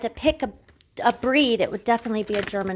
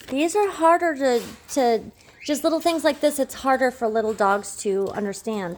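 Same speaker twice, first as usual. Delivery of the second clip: usual speaking voice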